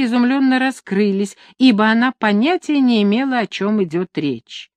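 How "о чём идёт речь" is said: ordinary delivery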